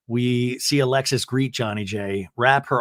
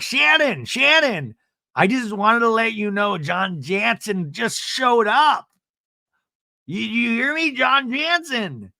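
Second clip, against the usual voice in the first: high, slow, drawn-out warning; hammed-up high slow alert; mock urgent alert; 9 semitones higher, slower than usual. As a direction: stretch out the name in high alarm. Imitating Emily's urgent alert.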